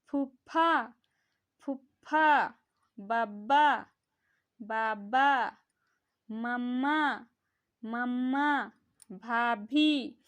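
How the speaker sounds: teacher drilling lip consonants; exaggerated lip sounds. Shown as a demonstration. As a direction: pronounce every word from the lips. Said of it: "तो बोल के देखो तो".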